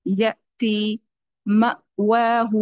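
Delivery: slow یاتی and مأواہ with a clear catch on the hamza; correct glottal stop. Shown as a demonstration. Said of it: "ایسے". (ہمزہ ساکنہ کی صحیح ادائیگی)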